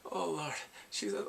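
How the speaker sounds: ordinary delivery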